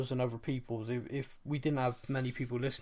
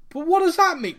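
put-on much higher, louder little-kid voice; young kid asking; 11 dB louder than usual. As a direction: play his younger self, puzzled and curious. Young self asking older brother.

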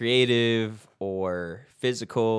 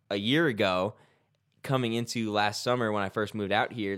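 usual speaking voice

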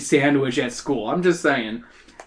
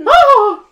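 short, loud high-pitched scream; 10 dB louder than usual. A narrator's reading. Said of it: "She screams".

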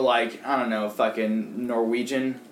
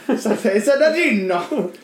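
mock Italian accent; 8 semitones higher, 4 dB louder than usual. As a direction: stretch it out in cartoon Italian. Mock Italian version of Gene.

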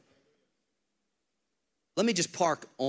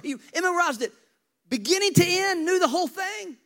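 rising to a high, loud peak; building emphasis; 6 dB louder than usual. Acted. Pastor stressing Nicodemus knew the Torah, loud build. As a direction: build it up and hammer it.

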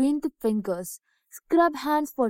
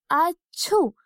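lightly acted sneeze, a little higher; mock sneeze; 5 semitones higher than usual. Girl sneezes into her hands, playful mock sneeze. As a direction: fake a small sneeze. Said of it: "as she sneezed".

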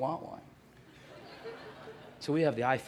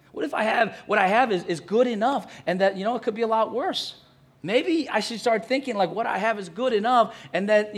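loud, raised preaching voice; preaching at full force; 6 semitones higher, 7 dB louder than usual. Acted. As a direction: lift the congregation with fervent conviction. Preacher builds to new perspective.

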